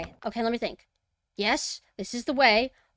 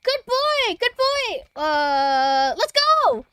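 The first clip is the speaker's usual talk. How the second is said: excited dog praise; 13 semitones higher, 9 dB louder, much slower than usual. Real, not acted.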